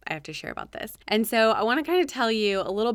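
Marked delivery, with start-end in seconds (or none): 0.1-0.9 quick breathy aside to herself, almost a whisper; hushed realization. Retold or imitated. Podcaster recalling her eureka moment, hushed and quick. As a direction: rush it out under your breath, half whispered. Sudden realization while reviewing numbers.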